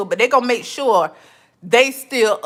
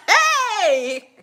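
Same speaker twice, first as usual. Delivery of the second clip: laughing hey; 8 semitones higher than usual. Retold or imitated. Laughing call to friend.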